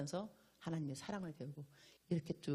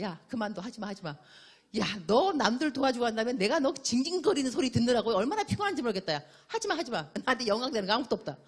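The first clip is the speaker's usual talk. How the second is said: louder, higher exasperated scolding; fed-up Lord; 6 semitones higher, 7 dB louder than usual. Retold or imitated. Preacher voicing the Lord, fed up with whining, louder, higher exasperated scolding. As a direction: scold like someone sick of the noise. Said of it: "주님도 그 말 들으려면".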